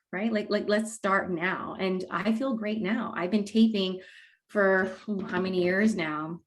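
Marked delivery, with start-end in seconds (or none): none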